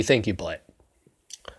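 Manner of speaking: whispered, fed-up repeat; exasperated whisper. Real, not acted. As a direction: hiss it under your breath in disbelief.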